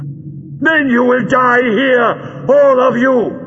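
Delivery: very high, slow villain shout; high-pitched villainous fury; shouted threat. Acted. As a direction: scream the sentence of death, drawing it out.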